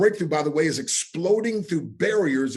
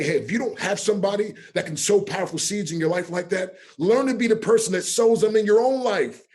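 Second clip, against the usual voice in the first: raised, higher voice, building in force; rousing exhortation; 6 semitones higher, 6 dB louder than usual. Retold or imitated. Speaker exhorting people he teaches, rousing and loud. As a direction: teach it like a preacher driving home the point.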